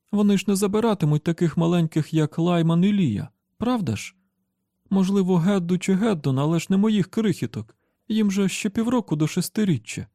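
high, anxious mother; distraught pleading. A narrator's reading. Mother begging that her children be spared, distraught.

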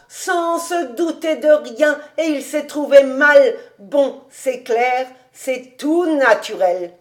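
loud "ah" settling into understanding; the penny drops, voice lifted; sudden realization. A narrator's reading. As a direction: work it out aloud, animated. Rabbi suddenly understands the cause.

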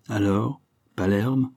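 ordinary delivery, nothing marked

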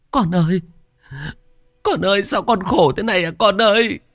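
high, keening lament of a bereaved mother; tearful wailing. A narrator's reading. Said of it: "mà mếu máo". (mếu máo khóc than thảm thiết)

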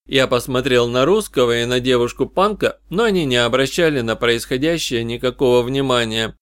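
ordinary delivery, nothing marked

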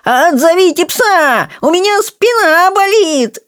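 very high, drawn-out squealing complaint; screeching. Acted. Rolla pinned by the dog, long high squealing whine. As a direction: squeal pitifully to be let go. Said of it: "Ролла заверещал".

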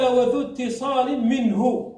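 slow, measured verse line; verse recitation. Retold or imitated. Teacher quoting the verse before explaining it, slow recitation. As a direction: recite with long, deliberate syllables. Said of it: "قال".

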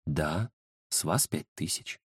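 low, slow and quiet; name it softly, taking your time; unruffled fortune-teller. Acted. Fortune-teller calmly names her fee. (невозмутимо, тихо, низко и медленно)

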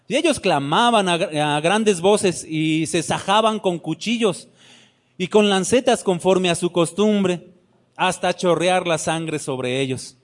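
ordinary delivery

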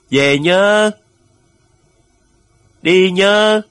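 long, loud, high-pitched calls; farewell shout. A narrator's reading. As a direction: call out across the waves to someone leaving. Shore keeps shouting farewell to ship. (hô vang từ xa, giọng cao và kéo dài)

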